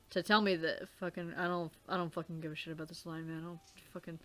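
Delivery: grumbling to himself as the take falls apart; frustrated muttering. Real, not acted. Actor quits the line, muttering to himself. Breaking character mid-read, frustrated.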